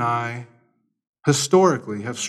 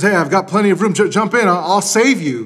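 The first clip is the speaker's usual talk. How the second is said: higher, louder call from the boat; 6 semitones higher, 4 dB louder, faster than usual. Acted. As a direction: shout over the floodwater to the man. Rescuer calls from the motorboat.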